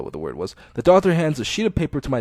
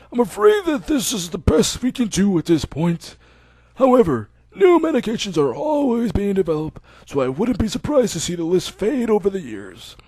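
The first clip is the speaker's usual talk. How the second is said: put-on old doctor; 7 semitones higher than usual. Old doctor telling Hisao he can go home.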